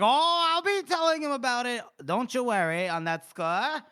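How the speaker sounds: hammed-up taunting assurance; mock reassurance. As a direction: stretch it out, loud and high. Acting out Felnick's gloating retort.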